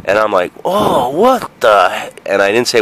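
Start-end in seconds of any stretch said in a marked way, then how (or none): 0.7-2.3 put-on slow, higher kid voice; kid reacting. Boy smelling a fart, puzzled and slow. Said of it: "I was like".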